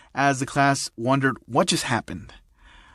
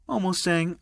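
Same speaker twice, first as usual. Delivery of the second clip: usual speaking voice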